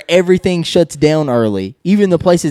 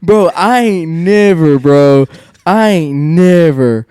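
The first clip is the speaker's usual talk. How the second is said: high, slow, stretched out in laughing disbelief; 3 semitones higher, 5 dB louder, slower than usual. Real, not acted. Telling friends the story, laughing in disbelief. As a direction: drag out the words, half laughing.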